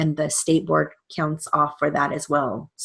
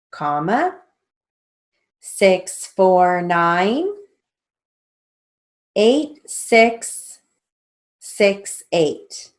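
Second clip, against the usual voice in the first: slow, spelled-out dictation; dictating; 2 semitones higher, 4 dB louder, much slower than usual. Shown as a demonstration. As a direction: read it out slowly for the class. Steno teacher dictating names slowly.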